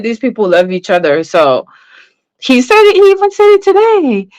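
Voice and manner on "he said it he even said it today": excited high emphasis. Real, not acted. Stressing he admitted love.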